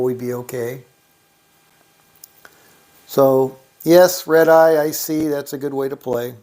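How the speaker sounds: usual speaking voice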